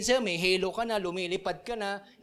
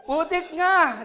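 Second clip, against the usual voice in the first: mock surprise; 7 semitones higher, 6 dB louder, slower than usual.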